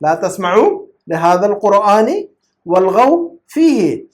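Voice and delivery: measured, phrase-by-phrase recitation; slow verse recitation. Shown as a demonstration. Teacher quoting a Quran verse to students, slow and deliberate. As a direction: recite it slowly with drawn-out syllables and breaks.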